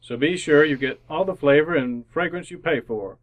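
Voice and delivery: ordinary talk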